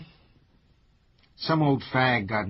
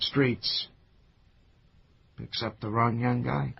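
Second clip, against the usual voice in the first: sympathetic; slower than usual. Acted. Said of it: "Now you sound sympathetic".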